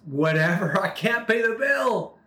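imitating a hopeless outburst, pitch climbing high. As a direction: act out giving up in a high, wailing protest. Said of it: "this woman, hopeless, just says".

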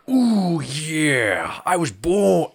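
high, loud wrestler growl; Macho Man impression. Co-host trying Macho Man as Bane, hammed-up. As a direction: ham up a Macho Man promo. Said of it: "Be like".

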